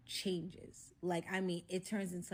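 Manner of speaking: ordinary delivery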